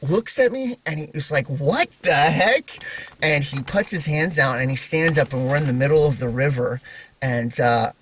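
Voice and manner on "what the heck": slow, drawn-out, higher-pitched disbelief; astonished brother. Retold or imitated. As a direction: imitate a stunned kid, stretching each word. Voices his brother's astonishment.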